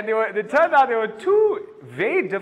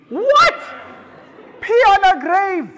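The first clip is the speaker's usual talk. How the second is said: high, loud What, outraged disbelief; shocked; 11 semitones higher, 6 dB louder, slower than usual. Acted. Comedian reacts to the fan's grave remark, high and loud. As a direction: squeal it in horror.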